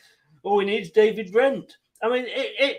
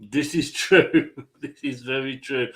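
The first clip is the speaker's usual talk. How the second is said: laughing; 5 semitones lower than usual. Real, not acted. Laughing at the turkey joke.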